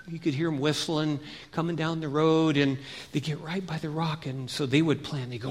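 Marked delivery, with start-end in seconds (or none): none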